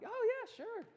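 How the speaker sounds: very high, strained little reply; mock-agreeable squeak. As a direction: squeak out an uneasy yes. Acted nervous reply to mentor's question.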